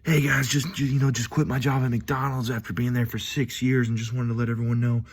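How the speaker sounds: post it like a proud update; hammed-up Facebook announcer voice; mock social-media guy. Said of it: "he was basically like".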